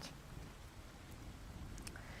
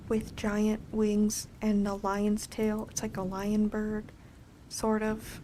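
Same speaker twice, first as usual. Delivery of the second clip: ordinary delivery, nothing marked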